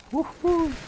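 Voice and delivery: high, loud victory whoop; excited cheer. Real, not acted. Gamer cheering a kill, high loud whoop.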